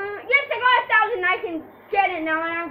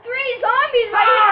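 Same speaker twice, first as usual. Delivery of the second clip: loud, high alarm call to a teammate; real mid-game shout; excited shout; 5 semitones higher, 11 dB louder than usual. Real, not acted. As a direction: yell it out as the zombies close in. Zombies appear during the game.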